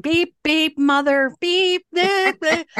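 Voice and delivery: high mock beeps with a swallowed curse; comic self-censored cursing at the pump; bleeped swearing. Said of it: "'cause I'm swearing the whole friggin' time".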